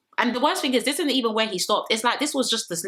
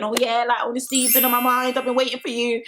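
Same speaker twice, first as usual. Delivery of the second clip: lightly imitating a pining partner, pitched up; mock devoted lover; 4 semitones higher than usual. Islander claiming to have waited for a lover, mocked in higher voice. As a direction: put on a higher, insincere reunion voice. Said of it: "it's giving".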